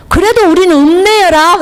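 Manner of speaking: mock-indignant Jeolla accent; 자랑스럽게 전라도 사투리로 받아치듯이; Jeolla dialect. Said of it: "내가 그랬어요".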